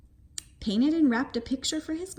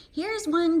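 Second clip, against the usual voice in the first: calling out; 7 semitones higher, 4 dB louder than usual. A narrator's reading. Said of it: "cried Froggy".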